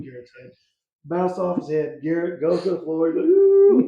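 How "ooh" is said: high, loud mock whine; whining ooh. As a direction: imitate a kid's pained whine after a knock on the head.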